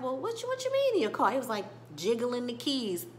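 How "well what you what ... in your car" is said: much higher, fast, incredulous squeak; a friend who can't believe his ears; cartoonish high-pitched "what you mean"; squeaky disbelief. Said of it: "And Bobby's like".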